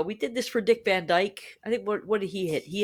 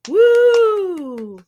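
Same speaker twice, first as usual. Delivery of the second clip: excited cheer; 11 semitones higher, 8 dB louder than usual. Real, not acted. Cheering Carol Burnett's birthday.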